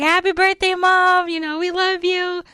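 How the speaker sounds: cheery sons calling out love; high loud imitated sons' birthday wish; sons' cheerful greeting. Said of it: "they're just like".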